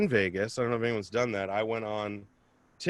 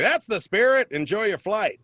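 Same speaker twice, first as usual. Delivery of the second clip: higher, cheery flight attendant voice; mock-cheerful stewardess sign-off; chirpy attendant; 9 semitones higher, 4 dB louder than usual. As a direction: sell the pun sweetly. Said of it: "She's like".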